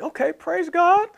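high, slow, questioning lilt; pondering praise. Acted. Listener hearing it a second time, tentative pondering. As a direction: let the praise rise into a question. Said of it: "Start to kinda ponder".

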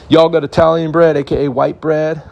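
high, put-on naive American asking; a tourist in Italy asking waiters in a dopey put-on voice; mock-clueless tourist. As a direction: play the lost tourist with a goofy voice. Imagined question in Italy.

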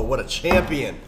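usual speaking voice

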